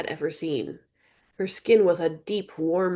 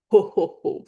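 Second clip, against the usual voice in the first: louder bird ho ho ho; raven chuckle; 4 dB louder than usual. Acted. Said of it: "Faye chuckled".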